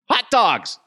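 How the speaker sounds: a jolt of memory, yelled; sudden excited shout. Retold or imitated. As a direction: blurt it out high and loud, like it just hit you. Sudden remembered answer, shouted.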